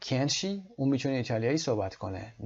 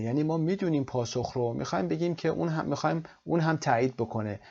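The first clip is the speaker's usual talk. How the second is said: ordinary talk, nothing marked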